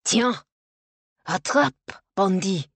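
loud, sharp French battle cries; French soldier's shout. A narrator's reading. French soldier attacking in a nightmare, shouting. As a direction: call out loudly as an attacker.

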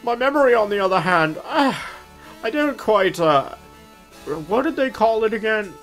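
put-on high quavering old man voice. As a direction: play a fussy elderly butler. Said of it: "My old man voice".

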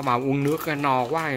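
normal talking voice

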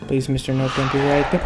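normal talking voice

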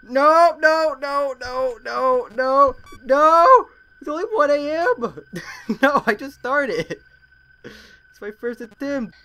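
frantic, slow, laughing despair; panicked pleading. Real, not acted. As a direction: beg the game in panic. Jumpscared early in game.

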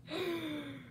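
soft trailing giggle; fading giggle. Real, not acted. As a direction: let the giggle die down. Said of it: "Oh, dear".

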